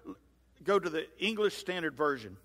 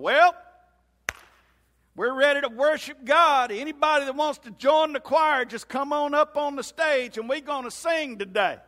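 high, booming church welcome; old-school pastor; 8 semitones higher, 8 dB louder than usual. Acted. Pastor inviting anyone up to join the choir, booming old-school style. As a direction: call the congregation up in a big, loud, raised voice. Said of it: "where the pastor gets up on Sunday morning".

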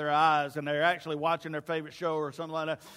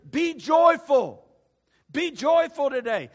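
louder and higher, joyful slowed and stretched; shouted greeting; 7 semitones higher, 9 dB louder, slower than usual. Retold or imitated. Greeting each other, shouting be joyful. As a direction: call out joy to a neighbor. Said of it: "they would shout it to one another".

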